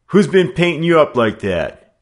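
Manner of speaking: high and loud, insisting on an answer; demanding leader. A narrator's reading. Gang leader to Brick, loud high demand. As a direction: bark the question at him. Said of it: "he demanded".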